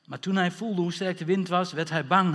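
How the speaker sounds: ordinary talk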